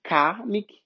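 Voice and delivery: kamic as "KAH-mik" with broad open A; wrong Portuguese A. Shown as a demonstration. Teacher showing the mistake, kamic with Portuguese A. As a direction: open the first vowel of kamic to plain "ah". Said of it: "não é o nosso A".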